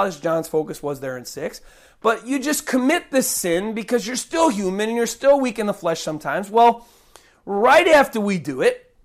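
usual speaking voice

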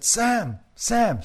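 high-pitched call to the neighbor; shouting. Acted. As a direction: shout the name out the window. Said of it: "she shouted".

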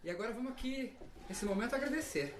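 guided meditation. Acted. Guru leads ritual, eyes closed.

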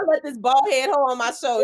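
normal talking voice

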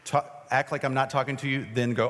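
ordinary talk

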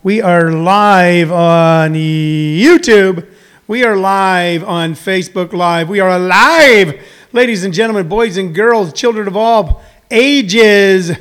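slow, drawn-out radio announcer intro; stretched-out showman welcome. Acted. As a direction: announce the show like a host opening the broadcast. Opening announcer-style show intro.

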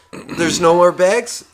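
surprised. Retold or imitated. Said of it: "wife and goes".

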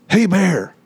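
high-pitched mild yell; retold yell. Fisherman yelling at the bear for a photo, mild high retold yell. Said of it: "The guy yells".

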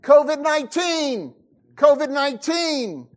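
high, loud mock warning call; mock cry out. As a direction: shout it like a leper's warning. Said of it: "has to cry out".

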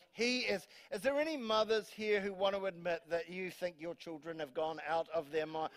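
ordinary talk

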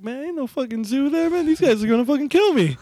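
high-pitched worried inner voice; mock panic. Sure these guys will kill him, mock panicked voice. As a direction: fret it high, as if doomed. Fearful thought on seeing the house.

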